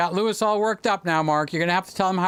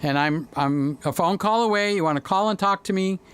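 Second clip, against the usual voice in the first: normal talking voice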